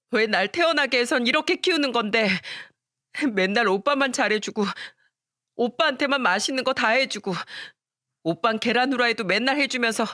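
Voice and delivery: high, tearful protest; tearful, indignant daughter. Acted. Hurt daughter tearfully accusing her mother of favoritism. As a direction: cry out at the unfairness.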